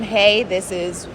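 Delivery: ordinary talk, nothing marked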